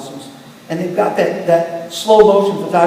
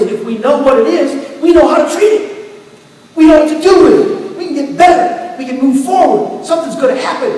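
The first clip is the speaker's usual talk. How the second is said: building higher and louder with eager hope; excited hope; 6 semitones higher, 4 dB louder than usual. Retold or imitated. Patient hearing his diagnosis named, building eager excitement. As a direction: climb higher with each hopeful line. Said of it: "I got really excited".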